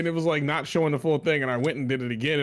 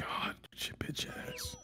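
breathy whispered insult; 17 dB quieter, slower than usual. Real, not acted. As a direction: hiss it under your breath. Whispered insult after the round.

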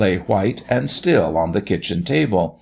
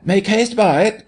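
high-pitched urging; urgent cry; 12 semitones higher, 3 dB louder than usual. A narrator's reading. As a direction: cry out to hurry him along. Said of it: "cried Jimmy".

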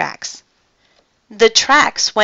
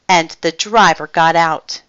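ordinary delivery

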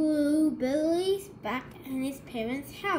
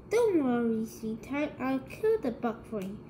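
ordinary delivery

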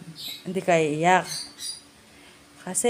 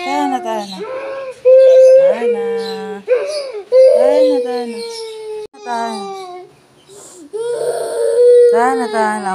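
much higher, louder calls of her name; calling out; 8 dB louder than usual. Real, not acted. Mother calls her wailing daughter's name, high and loud. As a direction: call her over her crying.

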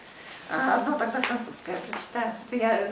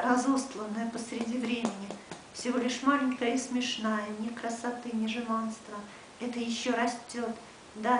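measured recitation, short pauses between lines; poetry reading. Acted. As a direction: read it as a poem, letting line endings sink. Reads Russian crib of the poem. (размеренное чтение стихов с паузами)

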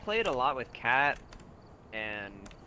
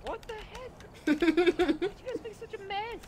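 squeaky scolding voice; 3 dB louder, slower than usual. Pretend. High squeaky mock voice scolding.

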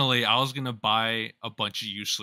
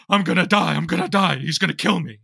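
higher, louder imitated panic; mock panic; 5 semitones higher, 6 dB louder than usual. Clarence fearing for his life, higher, louder mock panic. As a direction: play it like Clarence sure he's doomed. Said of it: "Clarence was just like".

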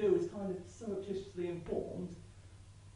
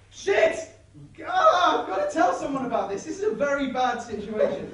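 shrill, loud panic, pitch leaping up; a frantic neighbour raising the alarm; panicked neighbour; 5 semitones higher, 8 dB louder than usual. Acted. Linda at her window panicking about the beating.

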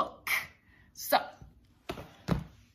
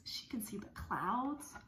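whisper; 7 dB quieter than usual. Acted. Whispered wonder in story.

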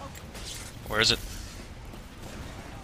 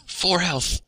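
alarmed cry; 7 semitones higher, much slower than usual. Real, not acted. Nearly dead in game.

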